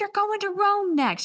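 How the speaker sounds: high-pitched, mock-soothing reassurance; supportive best friend. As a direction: imitate a friend trying hard to comfort. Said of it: "trying to be so supportive".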